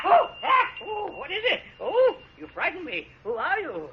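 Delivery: put-on old man shaken by a stranger in the garden, frightened. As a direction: voice jumping up in fright, catching his breath.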